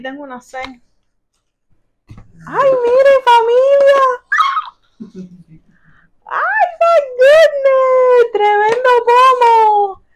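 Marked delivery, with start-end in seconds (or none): 2.5-4.0 very high, loud cry of delight; thrilled squeal. Real, not acted. Thrilled discovery while unboxing gifts. 6.3-10.0 high, drawn-out shout of amazement; overjoyed squeal. Real, not acted. Overjoyed at a big bottle.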